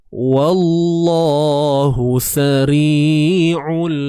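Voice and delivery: drawn out, melodic tajwid chanting; slow Quranic recitation. Shown as a demonstration. Teacher recites verse for students, slow chant. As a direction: chant each syllable slowly and evenly. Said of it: "teacher recites slowly".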